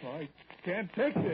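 slow, high, loud cry of exasperation; fed-up husband boiling over; angry outburst. Acted. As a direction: shout it as if at breaking point. Quarrel builds, husband at breaking point.

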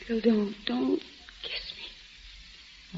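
fading to a whisper; breathless whisper. Acted. Mary pushing Bill away, whispering breathlessly. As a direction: pulls away, breathing the last words.

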